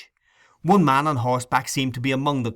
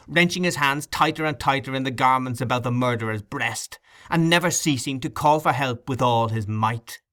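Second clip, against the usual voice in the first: ordinary delivery, nothing marked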